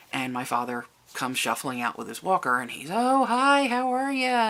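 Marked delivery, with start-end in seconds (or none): 2.9-4.5 bright, much higher and louder cheerful greeting; real friendly. Retold or imitated. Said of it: "real friendly like".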